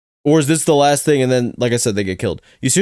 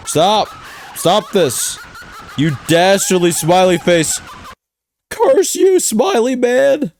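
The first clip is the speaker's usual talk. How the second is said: high, hammed-up theatrical outcry; melodramatic mock curse; 6 semitones higher, much slower than usual. Viewer curses the dastardly smiley face, hammed-up melodrama.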